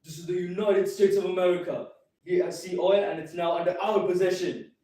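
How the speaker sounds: loud announcement over the speakers. Acted. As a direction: announce it like a voice on loudspeakers. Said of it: "on the speakers, I hear".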